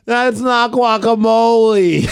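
high, slow, drawn-out wail; mock crying child. Dad imitating his daughter after the wasabi, mock crying. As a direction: imitate a three-year-old wailing in betrayal. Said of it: "and she's crying".

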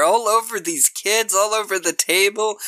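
ordinary delivery

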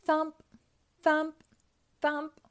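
each thump punched high and loud; storybook sound effect; thumping footsteps. A narrator's reading. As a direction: beat out the footsteps like a drum. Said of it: "went Pac-Man's feet".

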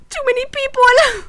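high, panicky Lloyd voice; overwhelmed Lloyd. Retold or imitated. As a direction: squeak it out in overwhelm. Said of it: "Lloyd was like".

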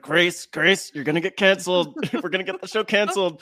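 fast, laughing mock warning; laughing mock panic. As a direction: laugh through a teasing panic. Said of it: "I was like".

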